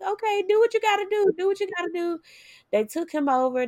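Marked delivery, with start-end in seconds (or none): 0.0-2.2 frantic okay. Retold or imitated. Retelling her crying consent at birth.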